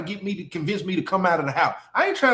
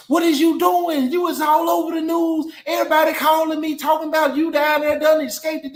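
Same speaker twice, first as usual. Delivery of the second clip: put-on girlfriend yelling on the phone; 6 semitones higher than usual. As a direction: yell it like she's upset at you.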